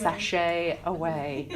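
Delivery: slow, drawn-out sassy sign-off; drag queen catchphrase. Retold or imitated. Quoting Queen Ru's elimination line to the audience, slow and sassy. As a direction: stretch it out like a drag host.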